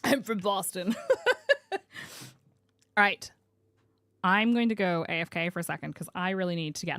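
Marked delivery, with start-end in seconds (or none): 1.0-1.7 sharp high laugh burst; high squeal of laughter. Real, not acted. Streamer laughing at chat's Boston joke, squealing.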